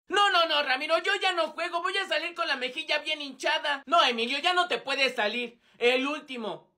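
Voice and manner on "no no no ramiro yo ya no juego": ordinary talk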